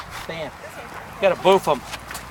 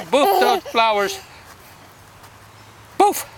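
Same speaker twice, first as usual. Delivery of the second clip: slow, giggly boof; laughing; 7 semitones higher, 3 dB louder, slower than usual. Real, not acted. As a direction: draw it out while giggling. Playing with toddler, laughing.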